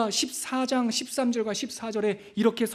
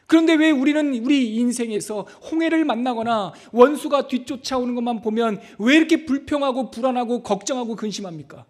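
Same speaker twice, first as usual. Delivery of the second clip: raised, pressing preacher's voice; higher, insistent sermon delivery; forceful preaching; 4 semitones higher, 5 dB louder than usual. Real, not acted. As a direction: drive the point home to the congregation.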